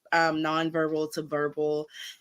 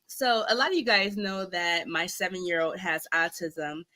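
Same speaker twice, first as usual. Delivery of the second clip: normal talking voice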